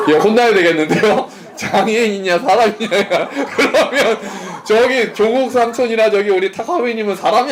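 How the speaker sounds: words broken up by real laughter; laughing while talking. Real, not acted. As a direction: tease through laughter. Laughing at student's answer. (웃음 섞인 놀리는 말투)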